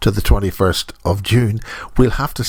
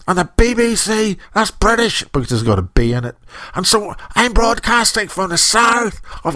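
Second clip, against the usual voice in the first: Irish lilt pitched well up, hammed for fun; mock Dublin accent; 9 semitones higher than usual. Radio host mocking himself in a Dublin accent. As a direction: put on a jokey Dublin brogue, high and sing-song. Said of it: "that's a mock Dublin accent".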